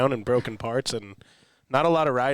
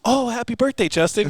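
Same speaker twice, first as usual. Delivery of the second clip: much higher, gushing mock birthday greeting; imitated well-wishers; 11 semitones higher, 3 dB louder than usual. Bystanders fooled by the gag, imitated cheery well-wishing. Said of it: "the people that are sitting here".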